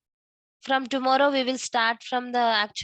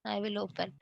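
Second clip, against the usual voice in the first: muttered to self, much softer; barely audible; 9 dB quieter than usual. Real, not acted. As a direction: drop to a near-inaudible murmur.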